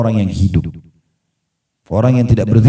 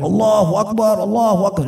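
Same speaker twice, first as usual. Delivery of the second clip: imitated battle cry; 12 semitones higher than usual. Preacher imitates companions cheering at the sight of Khaybar, mock yell. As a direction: call it out high like a charging crowd, volume held back. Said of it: "sambil teriak-teriak".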